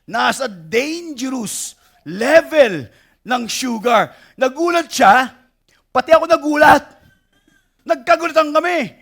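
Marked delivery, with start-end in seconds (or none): 4.3-9.0 excited. Real, not acted. Retelling the shock at 514.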